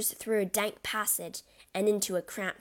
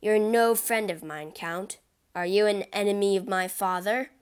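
much louder angry accusation; furious shout; 6 dB louder than usual. Acted. Albert confronts the Count at the opera, shouting. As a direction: shout him down in public.

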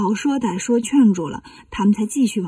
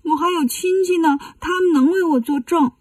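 put-on higher-pitched tearful child; mock crying; 5 semitones higher than usual. Frightened demon child pleads its case to Wukong, mock crying. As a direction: plead through put-on tears. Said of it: "但是哭着说".

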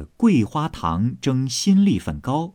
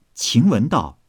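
usual speaking voice

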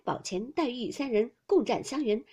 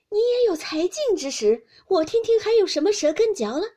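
high, loud, triumphant taunt; teasing challenge; 9 semitones higher, 8 dB louder than usual. A narrator's reading. Daiyu pushing Xiangyun to keep linking verses, teasing challenge. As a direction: crow at a rival who has run dry.

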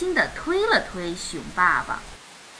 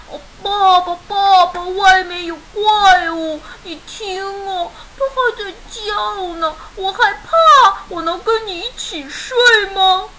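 scared little bear cub; 7 semitones higher, 5 dB louder than usual. Acted. Little son wakes dad because of monsters, high scared whimper. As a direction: plead in a high, tearful little voice.

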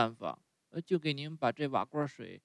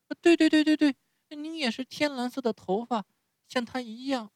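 much higher, begging through tears; tearful pleading; 11 semitones higher than usual. A narrator's reading. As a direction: plead with the fairy, near sobbing. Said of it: "哭得泪如泉涌".

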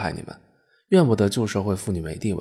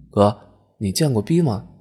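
plain reading voice

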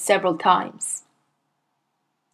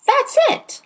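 put-on very high child's voice; small boy; 3 dB louder than usual. A narrator's reading. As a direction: say it like a cheeky little boy. Boy answers.

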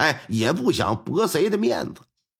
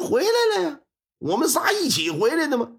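tipsy driver; 10 semitones higher than usual. Acted. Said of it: "酒还没醒呢".

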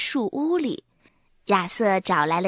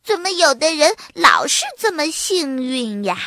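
envious whine, pitched up; 5 semitones higher, 4 dB louder than usual. A narrator's reading. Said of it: "羡慕地说". (羡慕地感叹)

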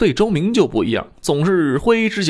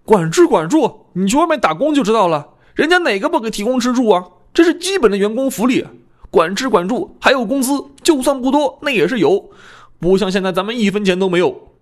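lightly voiced worked-up apprentice, pitched higher; agitated complaint; 6 semitones higher than usual. A narrator's reading. Apprentice argues with a colleague about getting no pay, mildly agitated. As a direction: argue indignantly, with a raised pitch. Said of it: "胖子情绪很波动".

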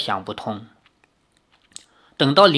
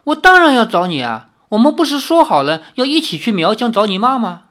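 higher and louder, taken aback; astonished protest; 7 semitones higher, 5 dB louder, faster than usual. A narrator's reading. 李逍遥, shocked that 灵儿 asks why he searched for her. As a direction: blurt it out in disbelief. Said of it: "李逍遥惊愕地说".

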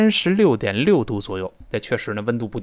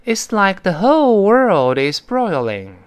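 slow, higher-pitched model reading, stretching "whole world"; model sentence reading; 7 semitones higher, 4 dB louder, slower than usual. Shown as a demonstration. Teacher replays the sentence for students, slow model reading. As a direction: read it out slowly with a big swell on "whole". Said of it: "把这句话再听一遍啊".

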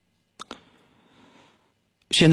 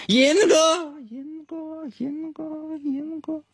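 fading repeated echo; canyon echo; 5 dB quieter, much slower than usual. Pretend. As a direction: call out and let each repeat die away. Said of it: "能听见回声吗". (山谷回声)

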